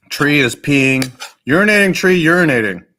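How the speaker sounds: loud, delighted outburst; excited shout. Real, not acted. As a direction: blurt it out in glee. Laughing at a funny drawing.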